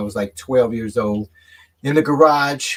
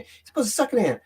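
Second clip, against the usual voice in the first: put-on higher, know-it-all kid voice; mocking kids; 8 semitones higher, faster than usual. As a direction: lecture him like a smug kid. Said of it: "They say".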